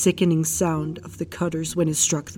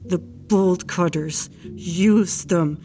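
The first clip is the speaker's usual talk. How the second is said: slow, forced words building to a higher-pitched plea; through gritted teeth; 4 semitones higher, much slower than usual. A narrator's reading. Husband begs wife to use bolt cutters, through gritted teeth. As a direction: force out each word separately, rising in intensity. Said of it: "He forced through gritted teeth".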